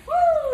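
high, loud whoop of delight; excited whoop. Real, not acted. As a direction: belt out a happy whoop. Grease sizzling, stepping back.

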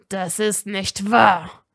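slow, forced through tight breath; pressed out. A narrator's reading. Draco denying the accusation, pressed out. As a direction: squeeze each word out. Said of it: "presste Draco hervor".

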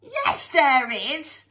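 high, slow call-out; shouted heckle. Acted. Joker calls back to teacher, cheeky shout. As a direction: holler it from the back. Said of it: "shouted a joker".

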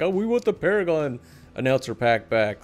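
ordinary talk

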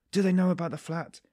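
the fear suddenly breaks through aloud; alarmed outburst. Acted. Anxious inner question, asked twice.

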